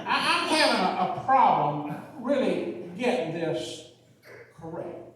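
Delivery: lightly voiced Louisiana country-lawyer drawl; a hint of a Louisiana drawl, slow and puzzled; mild Southern country-lawyer accent. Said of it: "And he has this accent".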